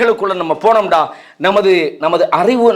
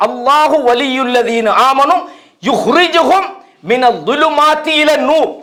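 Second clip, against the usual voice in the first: slow, raised-pitch Arabic recitation; Quran recitation; 5 semitones higher, slower than usual. Acted. Preacher quotes God's word to the congregation, slow high recitation. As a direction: recite the verse slowly, drawing out the long vowels. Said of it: "எனவே அல்லாஹ் சொல்றான்".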